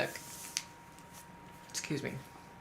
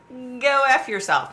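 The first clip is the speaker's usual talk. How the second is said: retorted curse; 9 semitones higher, 9 dB louder than usual. Retold or imitated. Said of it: "And I was like".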